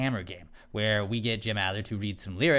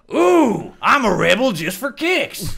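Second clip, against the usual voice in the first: shouted, slow TV-lawyer impression; Jim Adler hammering; 10 semitones higher, 8 dB louder, much slower than usual. Lawyer reading song lyrics with extra punch, shouting. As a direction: hammer each word, high and loud. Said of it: "put a little bit more hammer into it".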